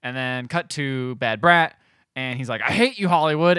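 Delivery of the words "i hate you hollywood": retold villain's hateful declaration, raised voice; mock-dramatic hatred; mock outburst. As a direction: imitate a bitter grudge, higher and louder. Acted villain's outburst in retold film.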